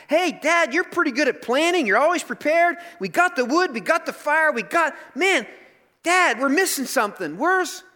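put-on high, excited kid chattering to dad; eager young son. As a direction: boyish excitement building to a question. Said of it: "He's like".

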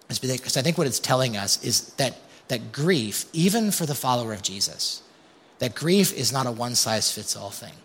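usual speaking voice

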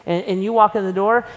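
ordinary talk

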